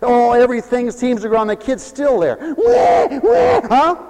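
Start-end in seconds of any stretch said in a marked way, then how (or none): 2.6-3.9 high, loud mock wail of a crying baby. Preacher imitating the kid still crying at home, loud mock wail. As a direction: wail like the kid who won't stop.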